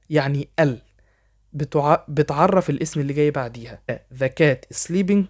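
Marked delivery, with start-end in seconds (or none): 3.9-5.2 very slow, careful English for learners to copy; slow dictation pace; slow model sentence. Shown as a demonstration. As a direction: spell it out slowly so students can repeat. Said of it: "حاول تقلدني".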